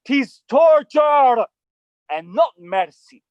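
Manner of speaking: normal talking voice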